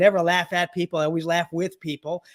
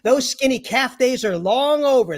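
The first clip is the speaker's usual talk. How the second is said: higher, louder mock old lady retort; sassy old lady; 6 semitones higher, 5 dB louder, slower than usual. Older patient snapping back at her doctor, hammed-up sass. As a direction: scold the young doctor like a feisty grandma.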